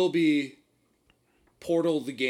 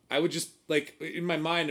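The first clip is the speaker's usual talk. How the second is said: normal talking voice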